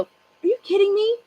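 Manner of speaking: a shocked wife's are-you-kidding; indignant disbelief. Retold or imitated. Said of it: "I go".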